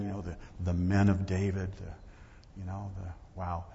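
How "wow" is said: ordinary delivery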